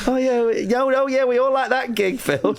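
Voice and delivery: high, mock-gushing reassurance; fake praise. Crowd humouring Phil about his gig, high mock-gushing. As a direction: gush insincerely at the old man. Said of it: "And they were like".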